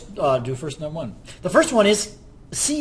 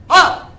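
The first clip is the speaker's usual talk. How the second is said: excited ah; 12 semitones higher, 12 dB louder than usual. Real, not acted. Excited exclamation.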